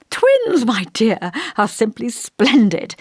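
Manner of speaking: a high, loud burst of joy on Twins; an old vicar thrilled by the news; delighted surprise. Acted.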